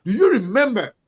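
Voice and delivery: ordinary delivery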